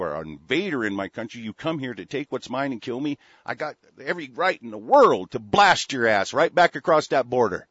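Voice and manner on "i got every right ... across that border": angry threat. Real, not acted. Threatening invaders in rant.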